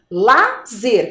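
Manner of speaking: "Lazer" in two separated syllables. Shown as a demonstration. Teacher asking children which syllable it is, syllable by syllable. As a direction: sound out "Lazer", pausing between "la" and "zer". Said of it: "Que sílaba é essa".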